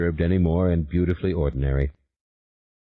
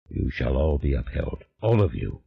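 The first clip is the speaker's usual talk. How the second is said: low, unhurried otherworldly demon, calm and sure; a patient spirit promising a way back; 7 semitones lower, 3 dB quieter than usual. A narrator's reading. The demon instructs Julien in a low, calm voice.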